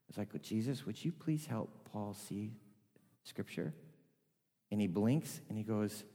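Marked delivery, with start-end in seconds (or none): 0.3-3.7 much softer, lowered prayer; quiet earnest prayer; hushed prayer. Retold or imitated. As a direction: pray under your breath. Quiet prayer beside Paul.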